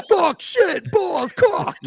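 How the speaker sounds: loud, high, word-by-word yelling; shouted swearing. Acted. Kid swearing out loud in church, high yell. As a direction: holler each curse gleefully separately.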